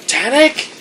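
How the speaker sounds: high, loud questioning shout; questioning call. Acted. Drana calls out questioningly.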